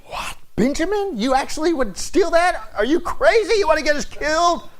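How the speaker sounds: high, alarmed voice of scolding brothers; hammed-up disbelief, pitched high; shocked brothers. Brothers rounding on Benjamin, high and scolding. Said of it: "They were thinking".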